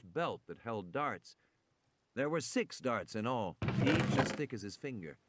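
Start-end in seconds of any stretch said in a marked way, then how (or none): none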